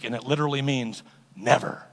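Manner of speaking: ordinary talk, nothing marked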